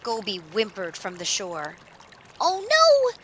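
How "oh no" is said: high-pitched, loud alarm; distressed puppy. Acted. Dog watching her friend cross the river, distressed. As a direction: squeal it high in dismay. Dog fears being left.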